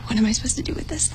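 fast unvoiced whisper; whispered quote. Recalling her free-time confusion to friends, whispered. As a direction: hush it out quickly, like a private worry. Retelling her own panicked thought.

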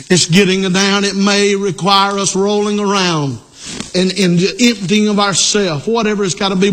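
usual speaking voice

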